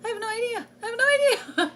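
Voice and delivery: high, loud, flustered I have no idea; rising panic. Retold or imitated. Mother overwhelmed by teaching her son, high flustered repeats. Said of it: "I'm like".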